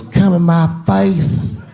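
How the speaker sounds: drawn out as if seductive. Retold or imitated.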